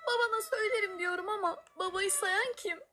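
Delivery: tearful, high-pitched complaint; mock sob while complaining; whiny crying.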